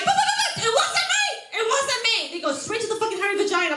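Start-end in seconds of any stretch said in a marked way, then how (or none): 0.0-2.3 high, slow frantic denial; hammed-up airport security panic; mock panicked protest. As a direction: squeal your innocence. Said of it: "They see me, they're like".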